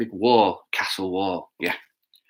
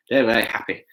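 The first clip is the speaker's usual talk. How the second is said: plain reading voice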